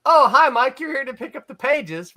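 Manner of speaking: artist's greeting. Retold or imitated. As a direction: greet the visitor as if nothing is odd. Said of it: "the artist, uh, s-, says".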